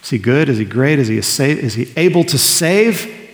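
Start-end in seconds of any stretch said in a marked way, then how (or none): none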